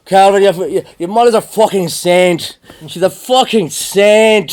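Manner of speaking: put-on loud, high Irish accent, slowing on saint; imitating loud Irishman. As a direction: boom it like a drunk Irishman praising a mum. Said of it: "Jerry's like".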